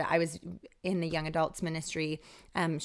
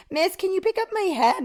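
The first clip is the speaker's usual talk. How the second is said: put-on a high little boy asking, much higher and a bit louder; child's voice; 13 semitones higher, 6 dB louder than usual. Little boy asking his helper to lift his head, high child voice. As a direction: voice the small boy sweetly asking for help.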